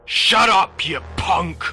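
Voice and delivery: high, loud burst of anger; explosive scolding yell; furious shout. Acted. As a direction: shout the kid down. Father shouts down his son.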